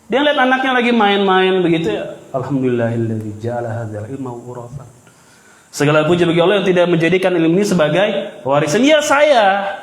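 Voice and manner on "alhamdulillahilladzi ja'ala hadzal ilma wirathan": low, soft murmured Arabic prayer. Retold or imitated. Imam Malik quietly thanks God on seeing his son. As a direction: murmur the praise under your breath.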